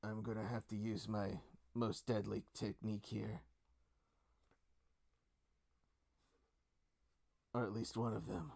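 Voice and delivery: understated in-character villain line, kept low-key; lightly voiced opponent. Game master voices the foe announcing his technique, lightly in character. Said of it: "he goes".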